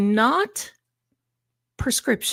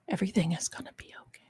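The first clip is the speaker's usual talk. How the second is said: soft whispered comfort; whispered reassurance; 11 dB quieter, much faster than usual. Real, not acted. Streamer soothing her viewers, whispered reassurance. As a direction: hush it to them like a secret.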